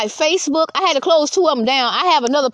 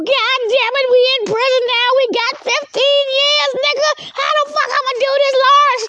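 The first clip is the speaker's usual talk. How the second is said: very high cartoonish wail; mock prisoner lament; 12 semitones higher than usual. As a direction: mimic a panicking new convict in falsetto. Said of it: "you motherfuckers going to jail too".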